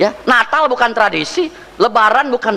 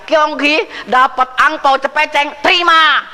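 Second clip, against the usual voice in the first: cheery festive greeting; 6 semitones higher, slower than usual. Retold or imitated. Preacher imitating a relative handing out red envelopes, cheery and raised. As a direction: sing out the New Year wish as if handing out red envelopes.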